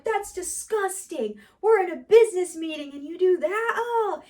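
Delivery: high, laughing, grossed-out protest; mock disgust. Coworkers recoil from Timmy's poop, high laughing disgust. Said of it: "everybody else is like".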